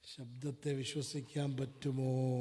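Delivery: slow, low question with a long last word; slow, deliberate challenge to listeners; drawn-out question. Real, not acted. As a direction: stretch "പറ്റുമോ" out slowly at the end. Same question repeated, drawn out.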